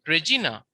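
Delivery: "Regina" stressed re-JEE-na; stress on second-last syllable. Shown as a demonstration. Teacher giving a Latin example, "Regina" stressed on GI. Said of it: "the accent is on G".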